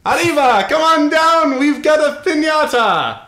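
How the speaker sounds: high, loud hammed-up Mexican accent; mock Mexican accent. Asked for voices, a loud hammed-up Mexican accent. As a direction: shout it like a bad Mexican cliché. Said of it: "Spanish or Mexican".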